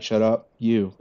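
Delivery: ordinary talk, nothing marked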